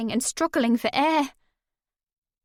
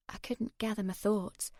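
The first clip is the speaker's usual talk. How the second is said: ordinary delivery, nothing marked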